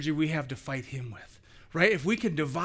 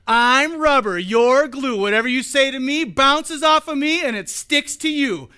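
put-on louder singsong kid's taunt; playground taunt; 5 semitones higher, 6 dB louder than usual. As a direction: chant it like a schoolyard comeback. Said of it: "There was the little taunt".

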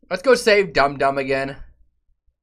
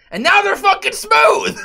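high, louder cracking yell; outraged shout; 4 dB louder than usual. Real, not acted.

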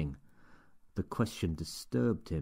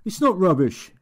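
much higher, louder protest of It's not rubbish; shout; 13 semitones higher, 13 dB louder than usual. Acted. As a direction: burst out defending the book. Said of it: "shouted Steve".

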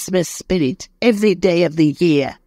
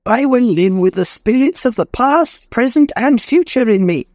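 tearful vow; 3 dB louder than usual. Acted. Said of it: "with tears in his eyes".

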